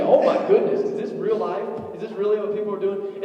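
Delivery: high, loud, amazed gasp at the footage; shocked hippie-watcher voice; mock astonishment. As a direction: play it wide-eyed and incredulous.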